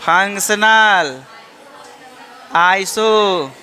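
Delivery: very slow, stretching functional and isomers for the class to repeat; slow drawn-out answer. Shown as a demonstration. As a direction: chant "functional isomers" slowly so students repeat it. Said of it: "कौन सा isomers है?". (Functional isomers को बहुत धीरे खींचकर बोलना)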